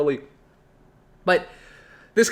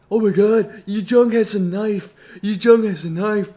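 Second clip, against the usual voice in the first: imitating kids passing on alarming news; excited mock-kid gossip, a bit raised; alarmed kids. Kids warn each other about a classmate with a knife, alarmed.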